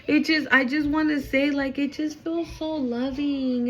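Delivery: moved almost to crying; real tearful overwhelm; close to tears. Real, not acted. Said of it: "I'm gonna cry".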